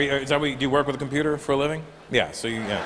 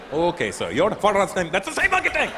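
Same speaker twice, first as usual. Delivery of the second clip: put-on Indian accent; 7 semitones higher than usual.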